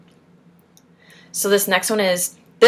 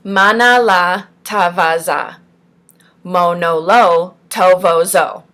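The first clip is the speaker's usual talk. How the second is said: slow, deliberate syllable drill; measured, carefully enunciated sounds; clear articulation; 4 dB louder, slower than usual. Shown as a demonstration. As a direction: draw out each nonsense syllable distinctly. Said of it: "you're supposed to be repeating it clearly".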